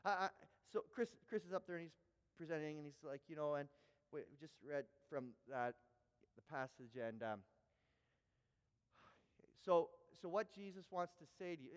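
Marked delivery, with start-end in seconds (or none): 3.3-11.7 halting, low, hesitant delivery; stammering preacher. Retold or imitated. A speaker stumbles in front of junior highs, stammering. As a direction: fumble along, losing the words. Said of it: "the stammering has gotten worse, his, his communication more paused".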